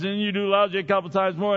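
normal talking voice

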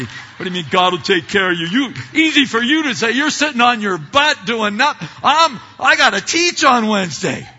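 loud, indignant rant with a laugh at the end; exasperated retort. Retold or imitated. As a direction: snap back at the friends, half laughing. Retorting to friends' easy comfort.

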